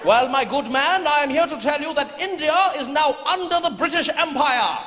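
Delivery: high, pompous British officer; pompous fussy courtier. Acted. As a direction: lord it over the farmer. British soldier announcing.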